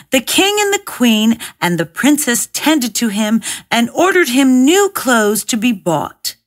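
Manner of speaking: ordinary delivery, nothing marked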